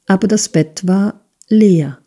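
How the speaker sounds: usual speaking voice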